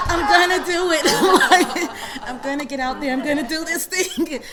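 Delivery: loud, high words through laughter; laughing. Real, not acted. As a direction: resolve spoken while cracking up. Laughing resolve retold.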